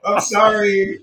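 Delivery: high, loud, giggling sorry; embarrassed laughing apology after a failed accent. Real, not acted. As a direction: apologize while cracking up. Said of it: "I don't know Scottish".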